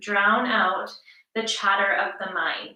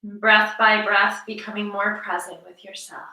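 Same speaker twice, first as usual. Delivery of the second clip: guided meditation; 4 dB louder than usual. Acted. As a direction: draw out key words, leaving room to breathe. Guides breathing before practice.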